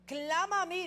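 loud, high divine call; voice of God calling. Retold or imitated. Pastor voices God inviting the people to cry out, loud high. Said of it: "le dice Dios".

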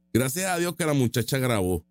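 normal talking voice

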